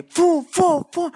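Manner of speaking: high, loud repeated whooshes; imitated whooshes. Imitating fifteen patrol cars going by, loud whooshes. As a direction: fire off whooshes, one per passing car.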